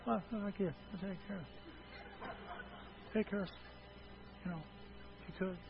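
very soft, halting mumble; faint, broken half-hearted asking; timid mumbled request. Shown as a demonstration. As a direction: repeat it meekly, almost inaudibly. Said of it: "if they asked you like this".

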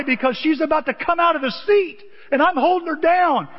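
panicked. Retold or imitated. Holding child down on ride.

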